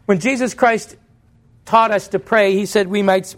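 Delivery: normal talking voice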